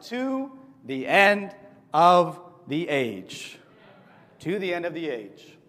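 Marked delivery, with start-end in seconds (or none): 0.1-3.6 very slow, louder announced title; drawn-out title. Shown as a demonstration. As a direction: stretch every word of the title. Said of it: "Title of today's lesson".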